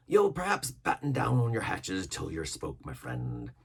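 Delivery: plain reading voice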